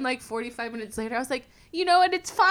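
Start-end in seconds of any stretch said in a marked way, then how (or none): 1.7-2.5 high, loud mock breeziness; sudden cheery acceptance. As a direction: swing abruptly to everything's fine. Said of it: "bipolar because then".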